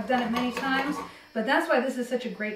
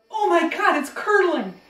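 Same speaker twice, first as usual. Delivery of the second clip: mock-shocked gasp, higher and louder; 6 semitones higher, 7 dB louder than usual. Host fakes alarm at curdling cream, hammed-up surprise.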